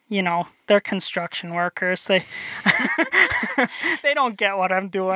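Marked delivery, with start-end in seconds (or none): 2.3-4.0 a high airy chuckle; quick laugh. Real, not acted. Chuckling to the host about his old crew. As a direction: laugh it off before explaining.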